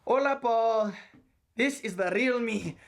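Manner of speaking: loud, drawn-out hammed-up Mexican accent. Employee unveils his practised accent to the boss, hammed-up Mexican accent. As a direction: put on a proud, broad Mexican accent. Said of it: "perfecting a Mexican accent".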